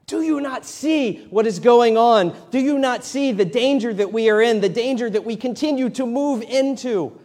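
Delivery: high, loud, frantic pleading; panicked plea. Retold or imitated. Disciples pleading with sleeping Jesus in the storm, high and frantic. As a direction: cry out to a sleeping Jesus in alarm. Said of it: "the disciples are like".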